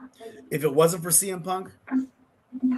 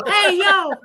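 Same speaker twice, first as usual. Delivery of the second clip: very high, loud kiddie squeal; four-year-old's voice; 9 dB louder than usual. Retold or imitated. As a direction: mimic a tiny child yelling a catchphrase. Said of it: "four at the time".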